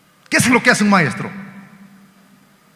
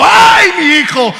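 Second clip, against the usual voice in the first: loud, high, drawn-out lament; hammed-up wail of a bereaved mother; 11 semitones higher, 7 dB louder, slower than usual. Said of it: "Había otra mujer llorando".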